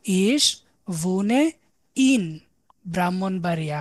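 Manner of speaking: each word drawn out, very slow; slow model sentence. Shown as a demonstration. Teacher modelling a German answer to students, very slow. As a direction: say it slowly so students can copy. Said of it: "তাহলে আমি কোথায় বাস করি?".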